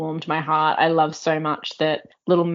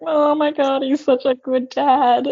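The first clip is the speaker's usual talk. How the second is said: high, gushing coo, slower at the end; 9 semitones higher, 4 dB louder, slower than usual. Retold or imitated. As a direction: swoon over the sweet dad. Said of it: "And I was just like".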